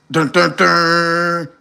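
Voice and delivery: slow, drawn-out imitated phone tone; mimicked dropped call tone. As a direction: hum the dead-line beeps slowly.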